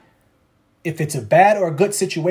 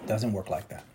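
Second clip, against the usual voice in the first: lower, softer "Doesn't work like that."; low murmured echo; 9 semitones lower, 13 dB quieter than usual. Acted. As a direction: murmur it to yourself.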